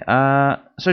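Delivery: usual speaking voice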